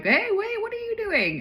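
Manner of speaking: very high, loud mock calling out; alarmed mom. Mom calling after children running outside, high mock alarm. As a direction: squeal it like a storybook mom. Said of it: "the mom is like".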